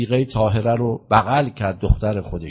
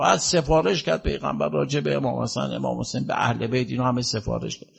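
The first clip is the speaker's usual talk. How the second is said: ordinary talk, nothing marked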